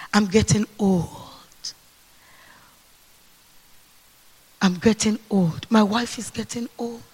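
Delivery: soft, low, tired voice; understated weary lament; weary old Abraham. Acted. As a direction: sigh it like a worn-out old man.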